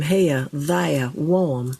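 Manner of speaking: "here," "there," "warm." all said without the R, British style; dropped R. Shown as a demonstration. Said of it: "brought that dropped R".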